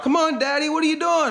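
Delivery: put-on high-pitched little kid voice; six-year-old son. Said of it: "My son, who's six years old".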